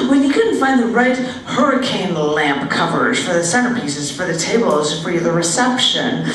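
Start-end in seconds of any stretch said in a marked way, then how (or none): none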